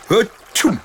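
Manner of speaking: loud high sneeze; a big dragon achoo; sudden hammed-up sneeze. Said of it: "Le dragon éternua".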